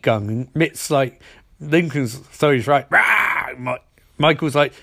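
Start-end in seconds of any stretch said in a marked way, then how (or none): 2.9-3.5 short high mock roar; hammed-up villain roar. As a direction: bark out a cartoon villain roar. Villain roars during heist recap.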